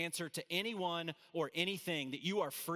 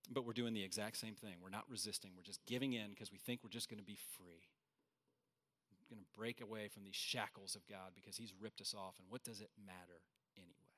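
much softer and lower, giving up; hushed resignation; 7 semitones lower, 10 dB quieter than usual. Acted. Preacher voicing believers quietly giving in to sin, hushed resignation. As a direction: drop right down, quiet and low.

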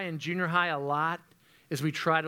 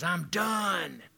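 imitated yell. Youth pastor retelling his son's losing football game, imitated yell. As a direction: imitate a fed-up player yelling from the sideline. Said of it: "He yells that from the side".

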